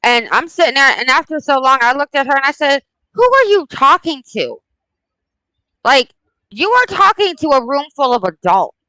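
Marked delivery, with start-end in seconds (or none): 3.1-8.8 mildly indignant, higher-pitched telling-off; reenacted parental reprimand, pitched up; retold scolding. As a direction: confront the child like a stern mother. Retells scolding a child at school.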